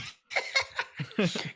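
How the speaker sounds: high, breathy burst of laughter; amused laugh. Real, not acted. Joking with friend about Corrupted Arena, amused laugh. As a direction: crack up mid-sentence.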